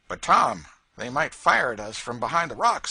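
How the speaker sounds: fretting about getting shot; Marks, wary slave catcher. A narrator's reading. Marks warning Tom, nervous.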